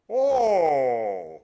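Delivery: a loud long "Oh." shaped as an inward O. Shown as a demonstration. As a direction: open up and sound "Oh." as an inward O. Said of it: "what we call the inward O".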